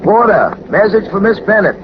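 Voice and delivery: calling out. Acted. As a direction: call out the message to the whole car. Porter calling out in train corridor.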